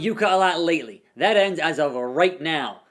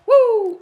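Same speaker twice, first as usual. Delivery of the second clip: high, loud wrestling woo; shrill showman's woo; Flair woo yell; 9 dB louder than usual. Pretend. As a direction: let out a ring-style woo.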